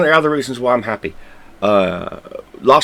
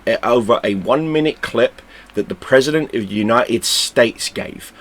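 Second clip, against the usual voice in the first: ordinary talk, nothing marked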